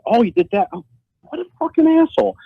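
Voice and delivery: put-on working yourself up while reading old messages; getting mad. Reading Mitch's old messages to himself, growing anger. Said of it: "now I'm getting mad".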